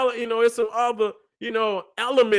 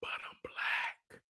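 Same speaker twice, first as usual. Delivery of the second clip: whispered aside; breathy whispered punchline; 17 dB quieter, slower than usual. Real, not acted. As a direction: hush it like a secret. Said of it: "whispered punchline".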